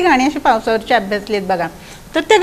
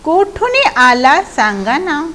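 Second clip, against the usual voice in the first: slow, higher-pitched verse line; poem recitation; 4 semitones higher, slower than usual. Shown as a demonstration. Teacher quoting the question to the frog, slow recitation. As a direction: recite it raised and drawn out.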